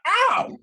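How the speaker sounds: sudden very high yelp of pain; short startled ow. Acted. Hag bites her own face.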